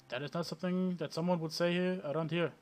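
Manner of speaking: the vendor corrects the player in a mock Indian accent; bad Indian accent. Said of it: "my really bad, uh, Indian accent".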